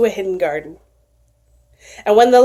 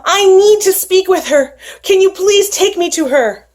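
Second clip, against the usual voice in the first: put-on pleading young man; 8 semitones higher than usual. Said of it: "he begged and he pleaded".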